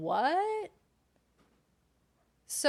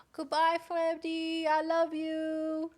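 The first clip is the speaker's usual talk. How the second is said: high, singsong, drawn-out goodbye; 6 semitones higher, 3 dB louder, slower than usual. Real, not acted. Saying goodbye to Freddy, high singsong baby voice. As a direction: coo it long and high.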